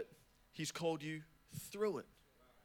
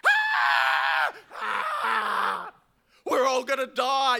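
screaming high that all will die; doomsayer; 7 semitones higher, 12 dB louder, much slower than usual. Retold or imitated. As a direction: shriek in mock terror. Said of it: "You're thinking of you".

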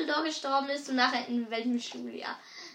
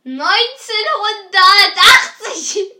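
laughing; 7 semitones higher, 16 dB louder, much slower than usual. Real, not acted. Laughing answer.

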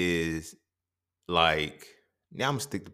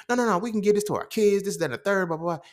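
high, loud, rushed insistence, fading at the end; excited protest; 11 semitones higher, 3 dB louder than usual. Retold or imitated. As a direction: shut him down, eager and loud. Arguing back against the dude.

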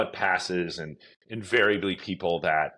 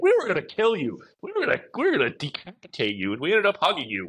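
high, loud mock disbelief; amazed fans; 9 semitones higher, 3 dB louder, faster than usual. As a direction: retell the fans' note in a raised, amazed voice. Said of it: "They're like".

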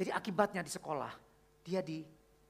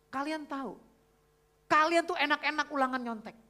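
loud, high-pitched retold telling-off; stern principal scolding; 10 semitones higher, 10 dB louder than usual. Principal scolding cheating pupils in front of everyone, stern and loud. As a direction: imitate a strict principal, voice raised hard. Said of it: "kepala sekolahnya itu tegas banget".

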